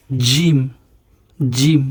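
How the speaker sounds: জিম with a hard "j" onset; firm জিম. Shown as a demonstration. Teacher models the Arabic letter, firm "j" in জিম. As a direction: press the "j" of জিম firmly, no "z". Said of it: "শক্ত হবে".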